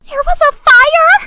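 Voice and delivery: high, loud alarmed question; alarmed shock. Acted. Mom learning there was a fire indoors, alarmed. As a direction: shriek it in disbelief.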